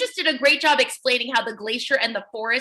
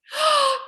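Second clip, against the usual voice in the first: dramatic gasp; 12 semitones higher than usual. Acted. Story read-aloud, dramatic gasp.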